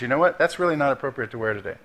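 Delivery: soft, hushed aside, lightly voiced; understated hushed prompting; quiet inner whisper. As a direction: gently nudge in a low, softened voice. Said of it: "hear his whispers".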